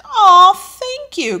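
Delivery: high, loud, slow thanks; delighted thanks. Real, not acted. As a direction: gush your thanks. Thanking a viewer.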